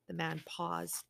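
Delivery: plain reading voice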